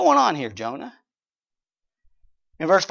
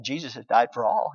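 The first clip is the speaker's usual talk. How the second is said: ordinary talk, nothing marked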